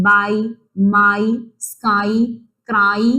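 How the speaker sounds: ബൈ, മൈ, സ്കൈ, ക്രൈ slowly, each "ai" stretched; one word at a time, drawing out ai in ബൈ, മൈ, സ്കൈ, ക്രൈ. Shown as a demonstration. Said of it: "ഐ എന്നാണ് നമ്മുടെ വൈക്ക് sound". (ബൈ, മൈ, സ്കൈ, ക്രൈ "ഐ" നീട്ടി പതുക്കെ)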